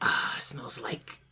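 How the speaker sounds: slow, breathed-out mock savouring; hushed, drawn-out mock bliss; savouring whisper. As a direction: whisper it slowly, as if relishing a smell. Pretends to savour sniffing his hand.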